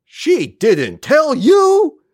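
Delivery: slow, high, loud hammy gasp; overacted line. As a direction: ham it up like bad community theater. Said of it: "like community theater and then down a step".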